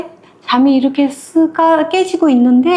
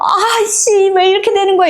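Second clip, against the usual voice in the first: high, irritated grumble; frustrated mid-run griping; annoyed; 8 semitones higher than usual. Retold or imitated. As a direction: curse at the shoe slipping off. Shoe comes off while running.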